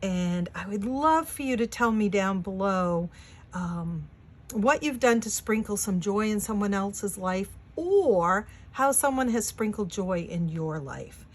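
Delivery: ordinary talk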